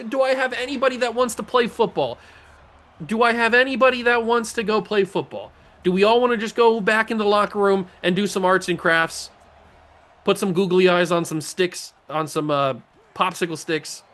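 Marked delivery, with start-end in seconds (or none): 0.1-9.3 raised, high-pitched frustrated demand; exasperated rant. Real, not acted. Player scolding his team for weak effort, exasperated. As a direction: challenge the team like a fed-up coach.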